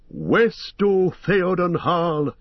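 high, drawn-out rallying cry; cried out. A narrator's reading. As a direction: call it out high and slow. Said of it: "cried Eomer".

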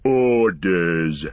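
low, slow growling orc; deep growl. A narrator's reading. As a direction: growl it deep like a brute captain. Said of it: "in a deep growl".

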